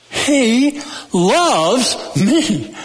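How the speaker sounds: very slow, each word apart, LOVES swelling high; stress on LOVES. Shown as a demonstration. Preacher shows the church another emphasis, LOVES stressed. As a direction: separate the words and lean on LOVES. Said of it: "any way you want to emphasize".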